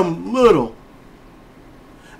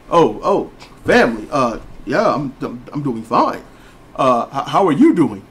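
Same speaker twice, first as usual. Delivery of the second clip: lower, stiff proper voice; proper talking; 7 semitones lower than usual. Retold or imitated. The son answering his family in a stiffer, lower voice. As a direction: talk like the polished man who forgot home. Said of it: "when did you start talking like this".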